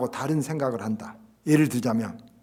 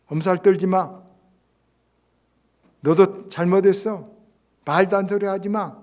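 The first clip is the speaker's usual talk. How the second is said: loud, higher scolding; dismissive rebuke; 5 semitones higher, 8 dB louder than usual. Retold or imitated. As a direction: shut the other person down. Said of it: "당장 이렇게 말합니까?".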